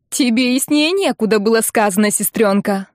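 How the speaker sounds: ordinary delivery, nothing marked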